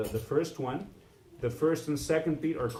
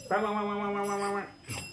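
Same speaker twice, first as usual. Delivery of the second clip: much higher, quick choppy bahs; fast wiggly wave sound; 7 semitones higher, faster than usual. Shown as a demonstration. Teacher showing the bad wiggly wave, quick high bahs. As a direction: rattle off the bahs like a jittery line. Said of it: "or if it's".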